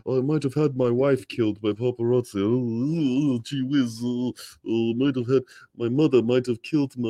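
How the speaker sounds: plummy mock-British royal, humming and dithering; hammed-up aristocratic mumble with drawn-out ohs; Charles the Third. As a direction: murmur like a pampered king at tea. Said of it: "Charles the Third is sipping tea".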